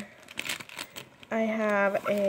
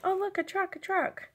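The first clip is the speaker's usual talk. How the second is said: higher, playful mock-excited voice; mock excitement; 9 semitones higher than usual. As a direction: pretend to be thrilled to cheer him up. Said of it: "I would be like".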